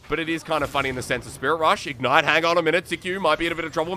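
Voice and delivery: fast, rising esports casting call; live play-by-play. Real, not acted. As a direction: ride the action like a live caster. Solo caster calling the game live.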